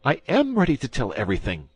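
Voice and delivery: high, loud frightened cry; high-pitched eager cry from a terrified prisoner. A narrator's reading. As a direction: Bonacieux cries out in high panic. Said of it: "cried Bonacieux".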